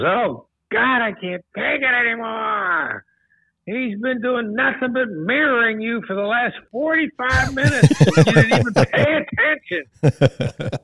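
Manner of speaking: high, slow, exasperated complaint; exasperated coworker. Retold or imitated. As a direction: whine it out, fed up. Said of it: "Brandon goes".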